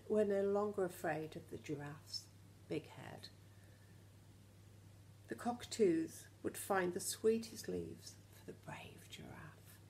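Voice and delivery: ordinary delivery, nothing marked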